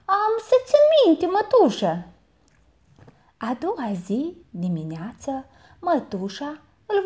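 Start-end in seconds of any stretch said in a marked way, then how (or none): 0.1-2.1 put-on high, eager boy answering; boy answers his aunt, high and dutiful; boy's voice. A narrator's reading. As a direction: pitch up into a young lad.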